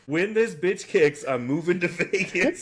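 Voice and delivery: ordinary delivery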